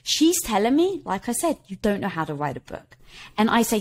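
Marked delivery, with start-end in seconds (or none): 1.7-3.0 low, soft matter-of-fact statement; understated verdict from the head; inner critic voice. Retold or imitated. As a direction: lightly voice the inner critic, dropped and quiet. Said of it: "in listening to the voice".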